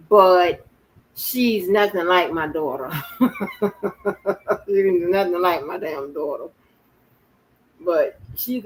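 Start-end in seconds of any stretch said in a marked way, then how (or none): 3.0-4.6 breathy, rattling burst of laughter; amused chuckle, higher than talk. Real, not acted. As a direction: laugh at your own remark. Laughs at own joke.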